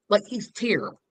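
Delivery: ordinary delivery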